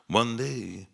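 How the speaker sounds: usual speaking voice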